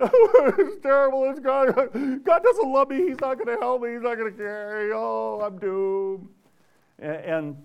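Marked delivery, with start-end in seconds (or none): 0.0-6.3 mock self-pity whine. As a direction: ham up a self-pitying believer. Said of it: "We're saying at that moment".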